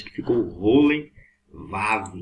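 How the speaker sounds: Rolenvav drawn out slowly; slow demo. Shown as a demonstration. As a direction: spell out Rolenvav slowly for the class. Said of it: "combinou um sinal maçorético". (Rolenvav bem devagar)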